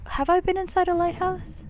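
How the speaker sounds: ordinary delivery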